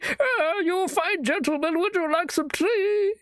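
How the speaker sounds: hammed-up kindly granny; sweet old granny. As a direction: offer tea like a doting grandma. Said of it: "this is some old granny".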